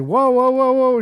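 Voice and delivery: high-pitched mock alarm; retold startled whoa. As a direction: act out trying to halt her panic. Retelling calming down a panicked partner.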